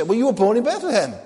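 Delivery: high, loud, quick objection; incredulous objector. Acted. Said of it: "Now you, you say".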